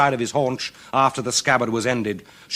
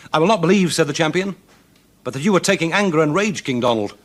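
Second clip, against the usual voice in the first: put-on raised, louder voice of a cocky young champion; bold champion; 7 semitones higher, 6 dB louder, faster than usual. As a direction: taunt the king boldly, voice up. Champion speaks to angry king.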